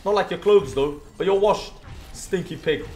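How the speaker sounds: usual speaking voice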